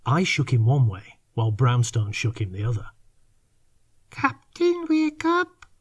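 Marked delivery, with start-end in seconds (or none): 4.1-5.7 much higher, soppy little creature voice; soppy creature whisper. Acted. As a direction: coax him awake, soppy and sweet. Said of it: "whispered in his own soppy way".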